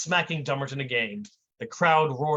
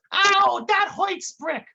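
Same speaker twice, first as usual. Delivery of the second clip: high, hurt whine after a smack; whiny Dummerton; 5 dB louder, much slower than usual. Acted. Dummerton after Brick slaps him, high whine. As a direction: yelp it like a slapped dope. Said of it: "whined Dummerton".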